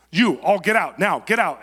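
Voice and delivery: loud barked order to clear the room; commanding shout. Retold or imitated. Dictator ordering his men out of the room, loud command. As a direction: throw everyone out, loud and sharp.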